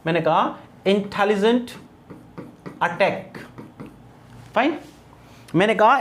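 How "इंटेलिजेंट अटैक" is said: "Intelligent", a pause, then "attack", each with टे stressed; stressed टे, word by word. Shown as a demonstration. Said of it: "टे sound है ये stressed है". ("Intelligent", रुककर "attack", दोनों में टे पर ज़ोर)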